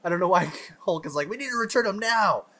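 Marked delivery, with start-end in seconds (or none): 1.3-2.5 high, rushed insistent whine; mock urgency. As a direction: mimic a pointlessly urgent demand. Mocks Hulk's urgent demand.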